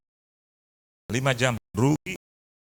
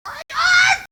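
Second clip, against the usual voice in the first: shrill, very high furious shout across the room; angry shout; 7 dB louder than usual. Retold or imitated. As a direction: scream her husband's name in rage. Istri datang mengamuk memanggil suami. (teriakan marah melengking)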